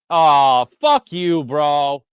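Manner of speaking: loud furious curse at the TV; angry outburst. Real, not acted. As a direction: yell it at the screen, fed up. Fan furious at penalty call.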